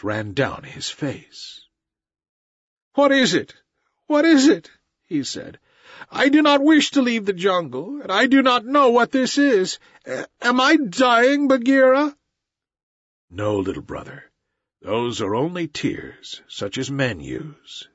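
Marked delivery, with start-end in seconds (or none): 2.9-12.2 put-on high, frightened, choked-up boy's voice; a child who suddenly starts crying and doesn't understand it; tearful boy. A narrator's reading. Mowgli asks Bagheera why he weeps, high and tearful. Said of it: "caught his breath and sobbed". 13.3-17.9 put-on calm the crying boy, lower and softer; gentle panther Bagheera. A narrator's reading. Bagheera comforts weeping Mowgli, low and soft.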